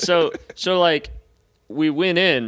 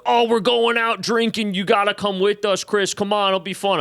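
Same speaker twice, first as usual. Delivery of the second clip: imitating friends' higher, excited coaxing; eager invitation; 7 semitones higher than usual. Frat friends coax Chris to come out drinking, eagerly. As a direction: talk him into coming along, pitched up.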